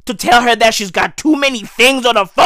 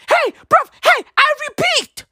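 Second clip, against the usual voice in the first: calling out. Real, not acted. Calling out to the listener.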